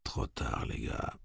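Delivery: breathy inner whisper, almost no voice; whispered thought. A narrator's reading. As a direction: whisper it to yourself. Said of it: "pensa Eddie".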